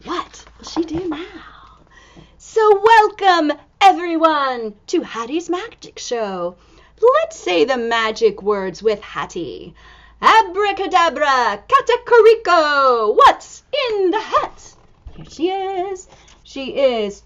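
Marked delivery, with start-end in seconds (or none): 2.4-6.5 showman welcome. Acted. Reader to children, loud showman welcome. 10.2-14.8 magic incantation. Acted. Magic words chant.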